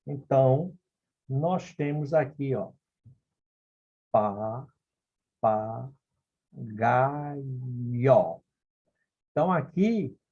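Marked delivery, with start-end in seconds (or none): 4.1-8.4 pa, pa, ga, yo spaced apart, each syllable on its own. Shown as a demonstration. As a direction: break papagaio into separate syllables with pauses. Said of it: "numa separação silábica".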